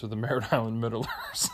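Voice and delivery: gang name breaking up into laughter; real laughter. Real, not acted. Man offers a silly gang name, really laughing through it. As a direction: crack up while offering the name.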